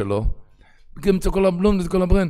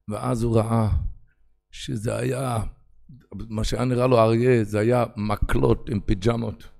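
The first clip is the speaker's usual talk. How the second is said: ordinary talk, nothing marked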